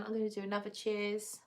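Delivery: usual speaking voice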